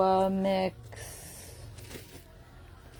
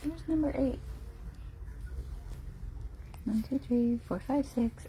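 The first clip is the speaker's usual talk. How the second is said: soft hushed voice, much softer; hushed counting; 3 semitones higher, 12 dB quieter than usual. Real, not acted. Counting sleeping puppies, hushed and soft. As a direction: count them quietly so they sleep.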